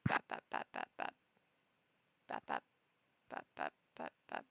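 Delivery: soft, voiceless little baps; imitating paw taps. Cat pawing her sleeping owner at three A M, soft baps. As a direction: pat out quiet, toneless baps. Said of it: "And she goes".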